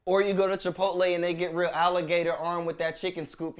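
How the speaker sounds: ordinary delivery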